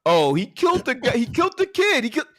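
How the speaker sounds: high, mocking parody of a friend's complaint; mocking whine. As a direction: mimic him in a high sneering voice. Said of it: "you can't sit here and say".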